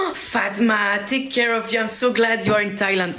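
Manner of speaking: high-pitched delighted squeal of words; excited gushing. Real, not acted. Streamer hearing a friend is in Thailand, excited gushing. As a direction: gush at a friend, voice lifted high. Gushing over Fatma's holiday.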